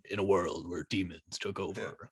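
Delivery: deep, hushed trailer-narrator impression; movie trailer voice. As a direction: intone it low like a film trailer voiceover. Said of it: "that movie trailer voice where it's like".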